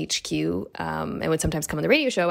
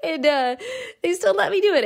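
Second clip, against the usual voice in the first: laughing; faster than usual. Real, not acted.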